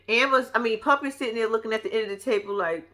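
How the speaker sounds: ordinary talk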